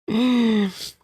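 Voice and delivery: a burst of loud laughter, high. Real, not acted. Laughs at the clip.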